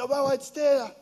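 high, loud excited call to the maids; high, loud excited little girl; excited child calling. Retold or imitated. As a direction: call the maids, thrilled a friend came. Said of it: "he said".

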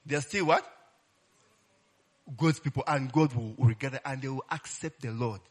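ordinary talk

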